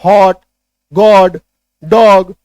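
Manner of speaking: हॉट, गॉड, डॉग with open "aw", one by one; हॉट, गॉड, डॉग as emphatic model words; short O examples. Shown as a demonstration. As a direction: punch each example loudly with a gap after. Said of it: "short में आपको याद रखना है".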